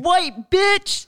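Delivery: loud, high mock yell; hammed-up crowd accusation; heckler's shout. Replaying the crowd's shouted insult.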